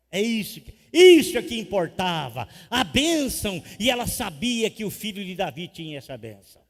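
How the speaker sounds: loud, high, climactic sermon shout; full-force preaching. Acted. Pastor promising the blessing is coming, loud climactic preaching. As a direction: drive it home to the crowd.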